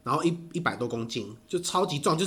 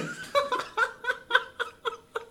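high laugh; 3 dB quieter than usual. Real, not acted. Laughing at reunion joke.

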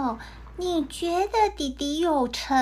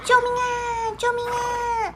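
high, frantic cries for help; calling for help; 10 semitones higher than usual. A narrator's reading. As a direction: shout for help as if someone is drowning. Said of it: "长靴猫就赶快大叫的说". (长靴猫高声大叫救命)

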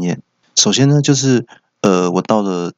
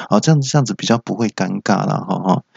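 usual speaking voice

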